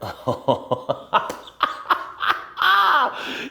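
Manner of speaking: high, airy burst of laughter; a long laugh swelling at the end. Real, not acted. Amused by his own big chorus.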